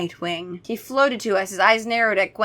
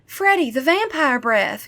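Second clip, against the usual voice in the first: slow, higher urgent call; lightly voiced Kara crying out; 5 semitones higher than usual. Kara urging Freddy to use the bottle, mild call. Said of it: "Kara cried".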